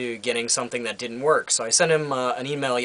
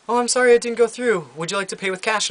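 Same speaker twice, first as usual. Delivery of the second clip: much higher, sing-song apologetic clerk; imitating the Costco cashier's apology, much higher; mock polite cashier; 9 semitones higher, 3 dB louder than usual. Store cashier quoted by customer.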